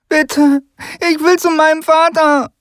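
put-on high begging child voice; a boy begging to go to his father; high-pitched desperate plea; pleading child. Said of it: "ignorierte sein Flehen".

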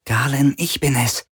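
high, small woman's voice; tiny female voice. A narrator's reading. Tiny drone identifies herself to the prisoner, high female voice. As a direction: pitch it up small to make yourself known. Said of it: "eine winzige weibliche Stimme flüstern".

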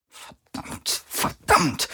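whispered curses rising at the last; muttering over and over. A narrator's reading. Captain repeating her curse, whispered, rising at the end. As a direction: breathe it out, then snap the last. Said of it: "immer wieder".

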